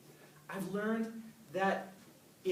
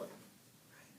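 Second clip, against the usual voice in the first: whispered aside; 18 dB quieter than usual. Real, not acted. Soft aside.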